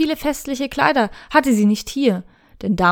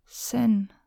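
softer, murmuring a name; murmured; 6 dB quieter than usual. Acted. Shirayuki thinks of Sen, soft murmur. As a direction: say it almost to herself. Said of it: "murmelte sie".

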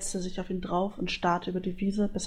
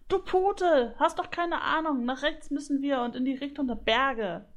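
high-pitched indignant goose chatter; squabbling goose; 6 semitones higher than usual. A narrator's reading. Goose insults her friend over directions, squabbling. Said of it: "Das zänkische Geschnatter".

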